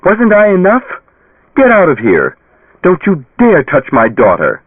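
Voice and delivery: high, loud, raging at the ghost; furious yell. A narrator's reading. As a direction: drive him away with fury. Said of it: "she cried".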